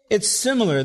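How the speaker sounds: normal talking voice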